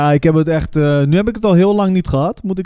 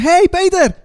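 high, bright-pitched prank greeting yelled out; sudden high holler from a car window; calling out; 13 semitones higher, 3 dB louder, slower than usual. Retold or imitated. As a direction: call out to a stranger like an old pal. Calling out to passing cyclist.

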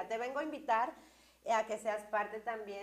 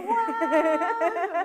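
amazed laughing wow, higher and louder; surprised; 7 semitones higher, 14 dB louder than usual. Retold or imitated. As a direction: gasp out a delighted wow. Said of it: "y yo".

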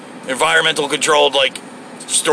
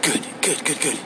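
breathy relieved sighs, softer; sweaty body parts sighing; 10 dB quieter, slower than usual. Retold or imitated. Driver voicing his sweaty skin, soft relieved sighs. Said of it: "All the sweaty parts of me are going".

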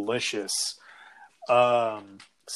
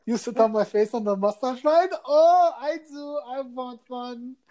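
much higher campy impression; mock flamboyant guy; 10 semitones higher than usual. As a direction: ham up a lederhosen-wearing flirt.

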